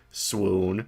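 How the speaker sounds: flat, low and quiet; deadpan monotone. Shown as a demonstration. Streamer reading Maya's swoon to viewers, deadpan monotone. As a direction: read it dead flat. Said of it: "deadpan monotone voice".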